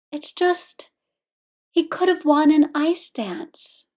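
high, hesitant, slow wistful regret. A narrator's reading. Victor frowning after the medal ceremony, wistful. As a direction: sigh over what might have been. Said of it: "said Victor wistfully".

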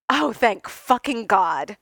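slow, loud relieved exclamation; heartfelt relief. A narrator's reading. Tony relieved he need not date Clint, heartfelt. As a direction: let the relief pour out. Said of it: "meaning it".

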